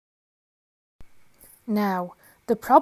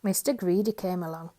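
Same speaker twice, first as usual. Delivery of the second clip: ordinary delivery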